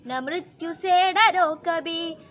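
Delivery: slow, loud, ringing declamation; forceful poem recital. Acted. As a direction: declaim every line like a battle cry.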